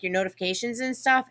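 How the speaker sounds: usual speaking voice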